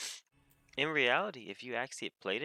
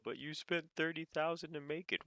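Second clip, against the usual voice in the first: high-pitched incredulous objection; mock protest; 8 semitones higher than usual. Crafter objecting to an undercutter, high mock protest. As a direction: protest in a raised, put-on voice.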